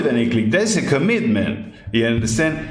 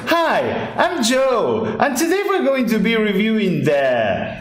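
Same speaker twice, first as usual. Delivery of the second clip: higher, bright-sounding reviewer greeting; mock YouTuber intro; 8 semitones higher than usual. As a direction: imitate a generic gear reviewer opening a video. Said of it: "And I said".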